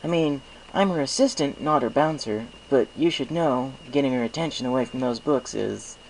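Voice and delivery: put-on high boyish voice, matter-of-fact; a kid assistant warning them; young dragon Spike. Spike warns they won't pull Twilight from books, high and boyish.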